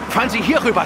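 high, loud order to steer over; shouted command. Acted. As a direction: call over the engine noise. Calling orders to boat driver.

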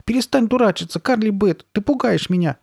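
put-on high, cross little-boy protest; high-pitched upset demand; angry and scared. A narrator's reading. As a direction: scold with fear underneath. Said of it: "Голос у него был сердитым".

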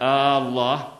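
الله opened on a long "Aaa"; lengthened hamza. Shown as a demonstration. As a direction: stretch the first vowel of الله as if asking. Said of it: "استفهاما". (مدّ همزة «الله» كالاستفهام)

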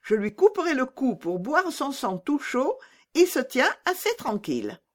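put-on high-pitched, threatening little robber girl; fierce robber girl. Robber girl boasting about her reindeer captive, high and menacing. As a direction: play a fierce child bandit, pitched high.

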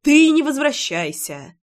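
narrated bark, moderately forceful. A narrator's reading. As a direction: snap it back at him, pitch jumping up. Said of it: "рявкнула я".

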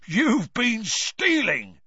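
high, loud, slow accusing cry; gleeful accusation. A narrator's reading. Filch accusing Peeves, loud and slow. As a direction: drag it out in triumph. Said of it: "Filch roared gleefully".

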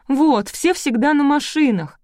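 high, loud, drawn-out whine; whining.

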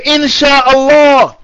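"Insha'Allah" drawn out slowly, high-pitched; emphatic Insha'Allah. Shown as a demonstration.

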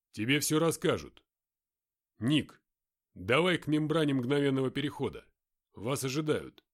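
a hint of a gruff fighter; lightly voiced tough soldier. Mark orders Nick.

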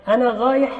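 غايح repeated, r swapped for gh; lisp r as gh. Shown as a demonstration. Teacher repeats the lisp for students: r as gh. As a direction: demo غايح with gh instead of r. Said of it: "قلبت الراء غينا".

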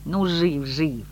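grudging admission, easing off; reluctant. A narrator's reading. Messenger admitting her love is alive, reluctantly. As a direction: concede it unwillingly. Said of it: "неохотно отозвался Азазелла".

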